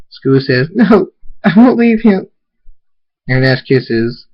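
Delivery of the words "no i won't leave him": high, loud defiant cry; distraught child's shout; anguished refusal. Acted. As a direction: dig in, refuse to be moved. Child refuses to leave father.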